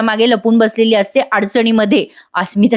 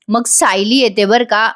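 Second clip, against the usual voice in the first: ordinary delivery, nothing marked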